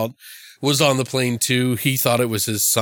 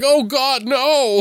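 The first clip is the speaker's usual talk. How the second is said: high, loud panicked wail; hammed-up cry of dread; mock scared gremlin; 13 semitones higher, 6 dB louder, much slower than usual. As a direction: squeal it as a terrified little monster. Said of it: "that it was scared".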